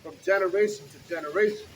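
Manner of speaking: booming street-preacher reading; scripture reading. Acted. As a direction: proclaim the verses to the crowd. Reads scripture aloud on street.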